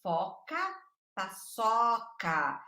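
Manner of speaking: "paçoca" drawn out very slowly; slow rhyme demo. Shown as a demonstration. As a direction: draw out "Foca, paçoca" like a child sounding it out. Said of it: "ela que tem que falar".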